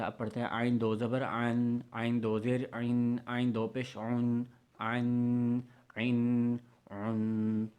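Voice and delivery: آئین squeezed from the throat; throaty Ain. Shown as a demonstration. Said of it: "آئین بھی بہت practice کی ضرورت تھی".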